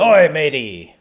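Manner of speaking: loud, hammy pirate growl. Pretend. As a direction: bellow it like a stage pirate. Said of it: "that's still a little piratey".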